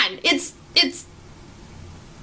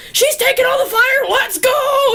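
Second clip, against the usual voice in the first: excited rallying cry; 11 semitones higher than usual. Retold or imitated. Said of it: "Steve's like".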